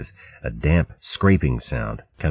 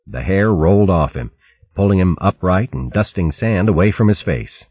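usual speaking voice

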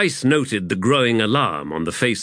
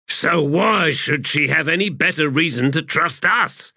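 demanding; 7 semitones higher than usual. A narrator's reading. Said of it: "Gessler demanded".

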